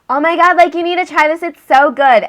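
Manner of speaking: put-on high, excited party-girl gush; gushing friend. Random friend urging her to try a drink, mock gushing. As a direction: imitate a friend raving over her drink.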